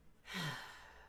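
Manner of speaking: breathy, disappointed sigh; imitated weary sigh. Streamer imitating Michael sighing behind her, weary sigh. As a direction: exhale like a tired, let-down friend. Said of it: "Michael just standing behind me like".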